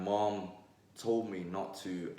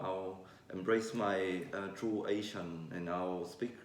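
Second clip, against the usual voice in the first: usual speaking voice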